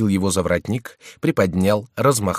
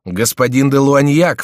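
loud, higher, drawn-out announcement; excited cry; 7 semitones higher, 9 dB louder, slower than usual. A narrator's reading. Dozens of men crying out their captain's name, loud. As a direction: call the name out, raised and stretched. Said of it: "вскричали десятки голосов".

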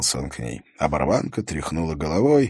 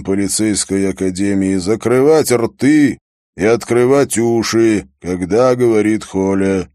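loud, higher-pitched briefing voice; commanding police chief; 5 semitones higher, 9 dB louder than usual. Acted. Police chief briefing his officers, loud and commanding. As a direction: address the squad forcefully, every phrase pushed out.

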